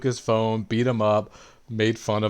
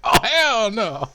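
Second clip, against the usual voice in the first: higher, louder, slow drawn-out protest; indignant; 12 semitones higher, 6 dB louder, slower than usual. Retold or imitated. Player protesting the skipped story, mock outrage. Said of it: "I was like".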